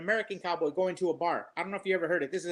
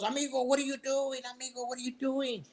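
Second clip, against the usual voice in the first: hammed-up higher Mexican accent, asked twice; mock Mexican cantina guy, a touch higher; 6 semitones higher, 3 dB quieter than usual. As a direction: joke Mexican accent, puzzled. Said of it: "And the Mexican goes".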